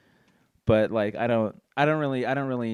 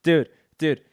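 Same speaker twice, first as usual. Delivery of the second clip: loud eager "dude, dude"; excited; 5 semitones higher, 10 dB louder than usual. Real, not acted. To a friend who shares his hot take, loudly excited. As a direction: burst in, fired up to agree.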